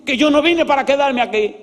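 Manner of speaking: high, loud, indignant outburst in imitation; furious yelling, retold. Angry visitor tells the pastor he will not stay, imitated shout. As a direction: yell it like the fuming visitor. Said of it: "me gritaba y me decía".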